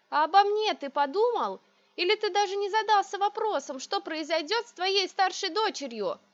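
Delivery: high, loud, near-hysterical outburst; breaking into a shout. A narrator's reading. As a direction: voice rising to a cry. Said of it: "И уже срываясь на крик". (срываясь на крик)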